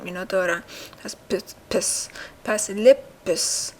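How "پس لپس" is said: very slow, drawn-out model; slow teaching demo. Shown as a demonstration. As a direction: say each word slowly for learners. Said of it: "teaching new word slowly". (آهسته و کشیده برای آموزش)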